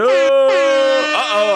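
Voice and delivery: high, loud uh-oh; drawn-out high warning call; hyped-up alarm. Real, not acted. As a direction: play up the excitement loudly. Hyping something coming.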